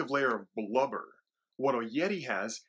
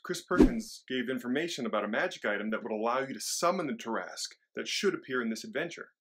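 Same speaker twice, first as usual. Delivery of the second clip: ordinary talk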